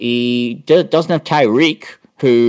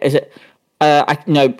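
normal talking voice

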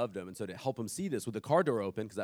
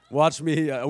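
ordinary talk

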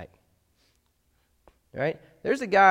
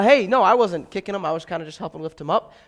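louder, higher innocent denial; mock crowd member caught kicking, playing helpful; hammed-up excuse, rushing the end; fake nice guy; 4 semitones higher, 8 dB louder than usual. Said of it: "nice guy bit".

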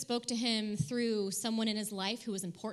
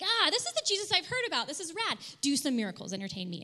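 high, loud burst of delight, easing off at the end; stoked Herod; 5 semitones higher, 4 dB louder than usual. Acted. Preacher voices Herod meeting Jesus at last, stoked. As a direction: gush like a thrilled fan meeting a celebrity. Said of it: "Herod's, like, stoked".

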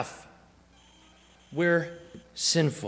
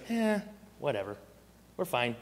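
dismissive; 6 dB quieter than usual. Retold or imitated. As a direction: toss it off like someone ignoring a warning. Acts out brushing off shark warning.

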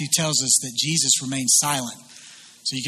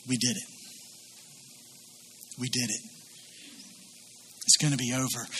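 much softer and lower, a quiet inner voice; thinking to himself; 5 semitones lower, 13 dB quieter, faster than usual. Retold or imitated. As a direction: murmur it like a private, relieved thought. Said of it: "thinking to himself".

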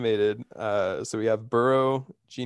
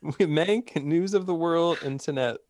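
laughing; 9 semitones higher, 4 dB louder than usual. Real, not acted. Still laughing, reading list.

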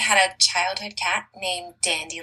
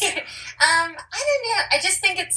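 much higher, giggly answer; laughing; 11 semitones higher than usual. Real, not acted. Guest laughs at the New York question, answering higher. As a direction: giggle while hunting for words.

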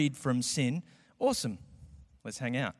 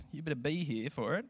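ordinary delivery

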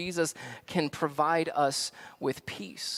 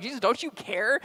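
losing their minds; 7 semitones higher than usual. Acted. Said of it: "they are losing their minds".

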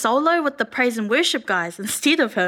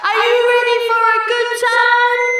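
high, loud showman call; excited stage hype; 8 semitones higher, 5 dB louder, faster than usual. Pretend. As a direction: hype the crowd up. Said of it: "And then I'll go".

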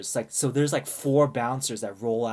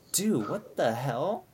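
slow, drawn-out incredulous question; exasperated disbelief, acted out; retold disbelief; slower than usual. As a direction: stretch it out, imitating your own dismay.

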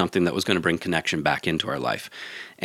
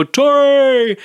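much higher, loud group hail across a pub; calling out a name; 7 dB louder than usual. Retold or imitated. Pub regulars calling to Tori as she walks in, loud cheer. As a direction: sing out the name like regulars welcoming a friend. Pub crowd greeting her arrival.

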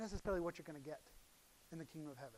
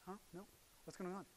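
ordinary talk, nothing marked

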